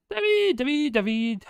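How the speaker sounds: high, loud sing-song call of a name. Real, not acted. Greeting a viewer in chat, loud playful call. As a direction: call David's name gleefully across the room.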